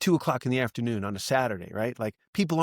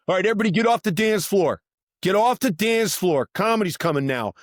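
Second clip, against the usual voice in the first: loud, raised announcement over a crowd; retold DJ yelling; 6 semitones higher, 4 dB louder than usual. DJ clearing the dance floor for comedy, mock yell. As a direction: imitate ordering a club to clear. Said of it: "yelling at people".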